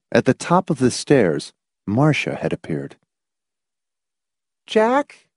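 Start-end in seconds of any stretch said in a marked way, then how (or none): 4.7-5.3 high, questioning call of his name; tentative, high-pitched question; hesitant call. A narrator's reading. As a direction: call out uncertainly, voice lifting. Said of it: "she called hesitantly".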